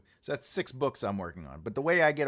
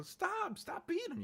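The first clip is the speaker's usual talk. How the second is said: much higher, pleading cry of a character; sample dialogue line; 5 dB quieter than usual. Shown as a demonstration. Editor demoing dialogue for a writer, high pleading cry. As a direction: voice Laura begging the guards to stop. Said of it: "But you could also be".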